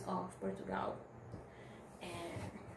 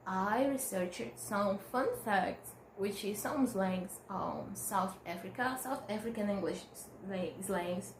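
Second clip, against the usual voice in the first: ordinary talk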